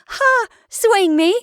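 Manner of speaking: very high, loud exclamation; delighted cry. A narrator's reading. Wife hanging from husband's neck, delighted cry. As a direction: cry out high in playful excitement. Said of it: "she cried".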